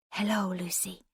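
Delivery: soft, hushed hello; a breathy near whisper from the door; barely a whisper. A narrator's reading. Stranger slips in, greets patient in hushed tones. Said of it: "It was barely a whisper".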